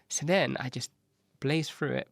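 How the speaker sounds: ordinary talk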